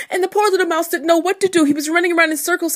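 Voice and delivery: fast, high, loud storytelling; frantic narration. A narrator's reading. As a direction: rush it along with the mouse's panic. Narrator carries the mouse's panic.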